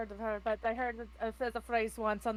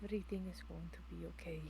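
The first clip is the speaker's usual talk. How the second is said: hushed, low, soothing voice; tender reassurance; 6 semitones lower, 16 dB quieter than usual. Acted. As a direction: softly coax a loved one back to safety. Soothing a transformed companion.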